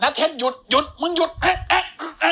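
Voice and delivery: high, hammed-up taunting voice; high mock villain. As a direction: wag a finger and scold in a squeaky pitch. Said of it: "ไอ้เหี้ยลาไนท์ก็บอก". (เสียงแหลมล้อเลียนตัวร้าย)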